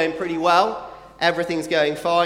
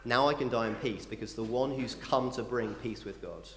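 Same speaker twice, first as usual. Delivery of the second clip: put-on hushed old man; 6 semitones lower, 11 dB quieter than usual. Old Simeon quoted, hushed.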